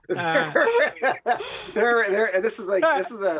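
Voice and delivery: high, loud laugh breaking through the words; can't get it out for laughing. Real, not acted. Host laughs at his own story, high and loud. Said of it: "The guys in the chat".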